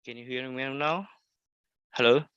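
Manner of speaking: ordinary talk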